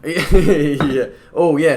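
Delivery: amused, loud laughing reply. Real, not acted. Laughs at the beer segue.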